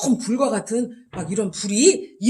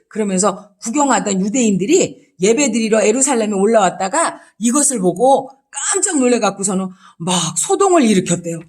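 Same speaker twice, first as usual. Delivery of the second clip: ordinary talk, nothing marked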